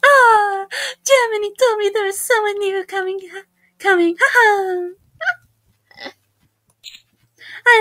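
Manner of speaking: high, exuberant Italian character voice; Italy. Acted. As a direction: greet the newcomer in a high mock-Italian voice. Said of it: "I wanna do an Italy voice".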